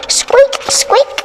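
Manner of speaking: high squeaky mouse voice; mouse squeaks. Acted. Mice keeping Dick awake, high squeaking.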